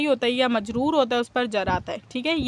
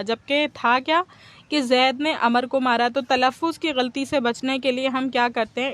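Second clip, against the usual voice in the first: ordinary talk, nothing marked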